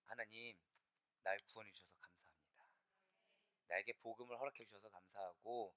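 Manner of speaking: soft, low prayer to God; low, subdued thanksgiving; hushed thankful prayer. Acted. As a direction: give thanks quietly, as in private prayer.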